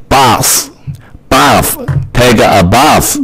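bath drawn out slowly with a broad British ah; slow British bath, open ah vowel; British accent. Shown as a demonstration. Teacher modelling British bath for class. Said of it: "英国音就是念啊".

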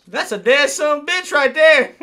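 loud laughing outburst. Real, not acted. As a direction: blurt it out loud.

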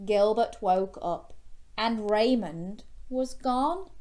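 plain reading voice

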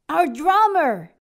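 light up at the idea; excited realization. Acted. Captain remembers the sea monster, loud excited cry.

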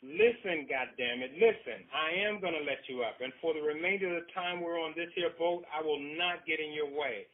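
loud, high, stern warning. Acted. Husband pinning his wife down, a loud stern warning. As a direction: lay down the law. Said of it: "he warned".

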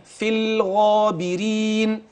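في الغابرين recited slowly as one piece; slow whole-word demo. Shown as a demonstration. Teacher reading the full word for the student, slow recitation. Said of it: "طب الكلمة على بعضيها،".

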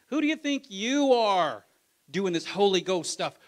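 high, loud jeering taunt; a scoffer shouting down a believer, loud and jeering; scornful shout. Retold or imitated. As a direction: yell the taunt with contempt.

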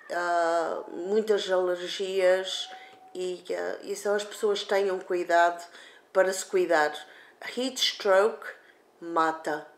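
ordinary delivery

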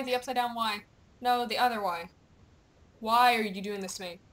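normal talking voice

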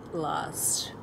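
trailing unvoiced whisper. Real, not acted. Telling viewers her friend looks lost, whispered. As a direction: let it fade into a whisper.

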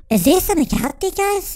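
a lightly voiced scared kid, rushing; mock frightened. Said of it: "So he's going".

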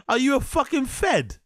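high-pitched mocking disbelief; scoffing, way up in pitch; incredulous. Real, not acted. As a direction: ask it as if utterly baffled. Mocking the storyteller's gun question.

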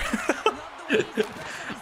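high unvoiced laugh; a quick airy laugh at himself; breathy laugh. Real, not acted. Laughing at his own mental block.

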